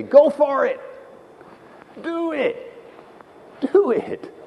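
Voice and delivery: egging you on; voice of the crowd. Retold or imitated. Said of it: "and they say".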